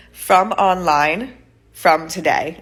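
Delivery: very slow, broken beginner phrases; slow simple words. Retold or imitated. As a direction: spell it out word by word like a learner. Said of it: "I said, in Portuguese".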